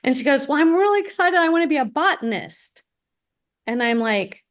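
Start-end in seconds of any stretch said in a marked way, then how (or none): 0.5-2.8 higher, bright mock-eager girl; imitating eager teen. Daughter tells the adviser her dream, mock-eager. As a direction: gush about being a botanist, lightly mimicked.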